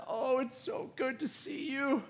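put-on high, soft old-lady voice; grandma. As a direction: put on a delighted, frail grandma greeting. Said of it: "your grandma says".

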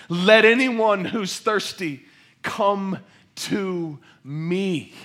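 loud, high, slow call over a crowd; piercing cry. Acted. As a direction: cry it out, stretching each word. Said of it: "piercing through the crowd".

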